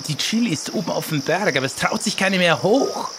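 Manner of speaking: ordinary delivery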